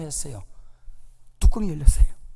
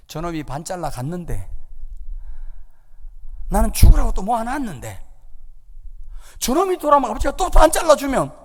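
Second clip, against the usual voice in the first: louder and higher, rising indignation; resentful rant; 6 semitones higher, 3 dB louder than usual. Retold or imitated. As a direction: voice the eldest son's grudge building up. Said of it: "이게 열받은 거예요". (점점 높아지는 억울한 분노)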